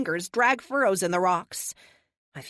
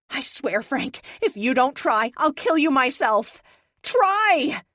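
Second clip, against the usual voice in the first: higher, louder angry threat; angry shouted threat at Frank; furious threat; 7 semitones higher, 7 dB louder, slower than usual. A narrator's reading. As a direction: threaten him in fury.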